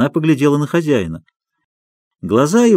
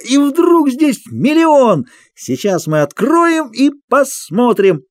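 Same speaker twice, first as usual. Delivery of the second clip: high, slow showman's clown voice; booming circus ringmaster; 9 semitones higher, slower than usual. Acted. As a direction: play the clown to the crowd. Clown performing for the circus crowd. (громкий голос циркового клоуна)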